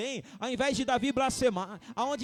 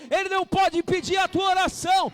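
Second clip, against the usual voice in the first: shouting, fervent sermon climax; rally the congregation with a loud call; full-force preaching; 7 semitones higher, 10 dB louder than usual. Acted. (pregação inflamada aos gritos)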